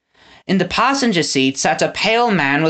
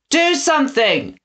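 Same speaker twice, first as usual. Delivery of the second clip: desperate cry; 11 semitones higher than usual. A narrator's reading. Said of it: "Winnie turned to Pickles and Trout".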